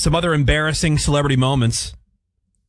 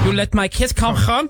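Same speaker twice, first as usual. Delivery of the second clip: mocking repeat; 9 semitones higher than usual. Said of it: "quoting the clip".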